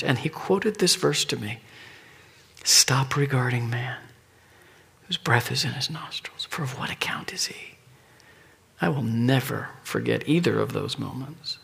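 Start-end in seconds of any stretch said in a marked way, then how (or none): 2.6-4.0 slow unvoiced whisper of the verse; quoting Isaiah to Todd in a whisper; whispered scripture. 5.1-7.7 unvoiced whisper fading softer; whispered quotation of scripture. Verse quoted in a whisper.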